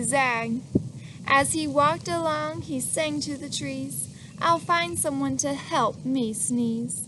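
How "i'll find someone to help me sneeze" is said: usual speaking voice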